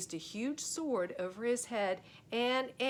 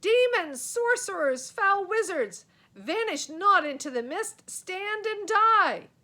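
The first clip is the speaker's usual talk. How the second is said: much higher, much louder ranting roar, slowing at the end; crazed knight bellow; 10 semitones higher, 10 dB louder than usual. A narrator's reading. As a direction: rage like a madman. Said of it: "He looked positively crazy".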